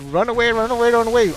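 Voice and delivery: high, loud panicked yell; panicked run away. Retold or imitated. Gamer panicking as Banelings close in on his Zealots, frantic high yell. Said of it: "I'm just freaking out, and I'm like".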